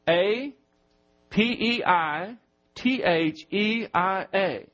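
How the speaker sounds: slow letter-by-letter spelling; spelled out. Shown as a demonstration. Preacher spells Greek word for margin notes, slow spelling. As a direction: spell it slowly, pausing between groups of letters. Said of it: "Maybe you can write this".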